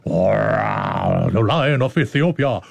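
low, slow, grand lion voice. Acted. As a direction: roll it out like a majestic lion. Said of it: "I love my voice when I do a lion".

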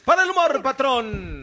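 high, loud hype call dropping at the end; high loud shout. Pretend. Comic launching the humor segment for the patrón, high loud shout. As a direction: yell it high, like a show opener. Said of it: "pero grítele en la iglesia".

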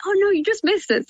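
high, sing-song mock regret; pretend disappointment. Mum keeping up the game with her daughter, pretend disappointment. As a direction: play up the pity for a small child. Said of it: "I just pretend I can see things".

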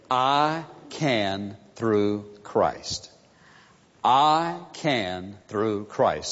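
each word laid down slowly and evenly; slow deliberate phrase. Shown as a demonstration. Pastor teaching the congregation a phrase, slow and deliberate. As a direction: spell out the four words slowly for them to learn. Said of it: "It's four words".

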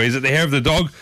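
usual speaking voice

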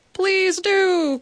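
the waiter calling out to Mister Casey; called out. A narrator's reading. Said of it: "called the waiter".

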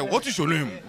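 "What is your name?" with wide eyes and a Nigerian lilt; imitating a Nigerian accent; Nigerian-style English. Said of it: "con los ojos y todo".

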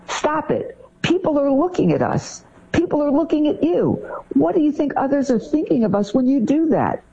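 high, tense hushed scolding; mock parent shamed in public, pressing; embarrassed scolding. Parent scolding a child because people are watching, tense.

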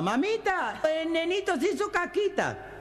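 put-on very high small-boy voice; little child voice. Little boy telling his mom about the baby, very high child voice.

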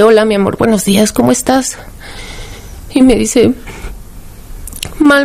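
telling the hardest part with emotion rising; near tears. Real, not acted. Mother recounting daughter's crisis.

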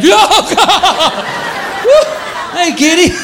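mock startled shriek; startled yelp. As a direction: jump with fright, then greet the cat.

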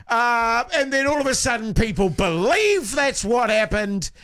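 ordinary delivery

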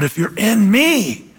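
slow "in me", leaning on "in"; stress on "in". Shown as a demonstration. Contrasting with in the world, "in me" drawn out. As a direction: stretch out "in me" in contrast. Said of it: "the preposition there is huge".

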